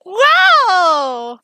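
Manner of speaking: loud, high amazed cry; excited wow. Real, not acted. GM amazed at the player's roll, excited wow. Dice roll result reaction.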